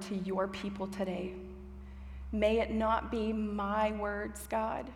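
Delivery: soft, unhurried praying voice; prayer. Real, not acted. Preacher praying to God before the message, soft and measured. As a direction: pray quietly, with pauses between phrases. I'm gonna pray.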